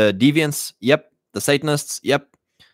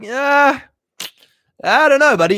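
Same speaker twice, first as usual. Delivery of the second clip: much higher and louder, airy "I don't know, buddy"; dismissive brush-off; 14 semitones higher, 5 dB louder than usual. Retold or imitated. Host brushing off the boastful bogans, high and dismissive. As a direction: wave them off with a breezy high shrug.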